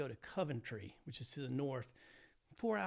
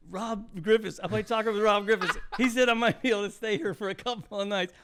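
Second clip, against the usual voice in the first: loud, higher, hurried and laughing; flustered explanation; 6 semitones higher, 11 dB louder than usual. Retold or imitated. Hitchhiker explaining himself to the brother with a golf club, loud and flustered. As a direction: blurt it out, caught off guard. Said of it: "I'm going".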